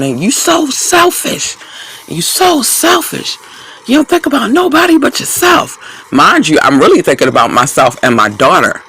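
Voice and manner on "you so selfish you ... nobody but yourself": mock screaming ex. Retelling her ex screaming at her, squeaky mocking shriek. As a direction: shriek it up high, mocking his tantrum. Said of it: "So he starts screaming".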